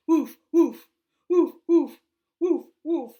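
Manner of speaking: loud woof woof barks; dog barking. Shown as a demonstration. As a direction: bark like a dog. Dog sound for child.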